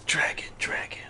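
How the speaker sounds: whispering. Real, not acted. Whispering at a dragon head.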